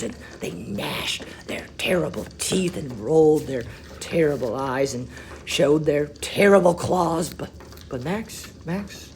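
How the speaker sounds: usual speaking voice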